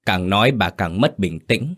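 usual speaking voice

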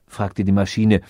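plain reading voice

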